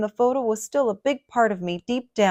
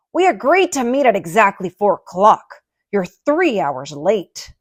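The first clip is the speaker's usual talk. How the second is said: fuming Lenny; 7 dB louder than usual. A narrator's reading. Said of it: "Lenny was fuming and furious".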